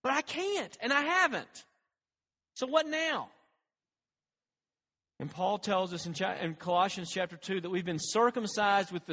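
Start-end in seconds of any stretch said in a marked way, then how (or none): 0.0-3.3 high-pitched plea at ordinary volume; pleading cry. Retold or imitated. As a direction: cry out helplessly to God. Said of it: "we cry out to him".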